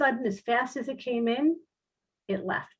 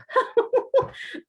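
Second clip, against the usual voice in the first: high airy burst of laughter; a real giggle, high and breathless; 4 dB louder than usual. Real, not acted. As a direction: cracks up laughing at her own story. Laughing at her own story.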